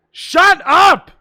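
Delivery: high, loud yelled shut up; meme shout. Pretend. Answering a donation with a joke, a loud shout. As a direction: blast it out as a joke. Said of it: "That's the reference, right?".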